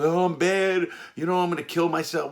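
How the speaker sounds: high, loud whiny put-on wail; mocking inmate act. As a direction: ham up a fake despairing inmate. Inmates putting on a show.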